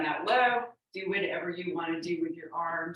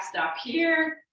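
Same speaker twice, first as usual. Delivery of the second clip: called out; 10 semitones higher, 5 dB louder than usual. Real, not acted. Instructor calls cue mid-workout.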